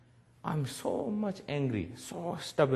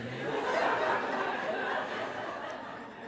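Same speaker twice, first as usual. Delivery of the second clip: quiet chuckle; 3 semitones lower, 6 dB quieter than usual. Real, not acted. Laughing about compassion for guards.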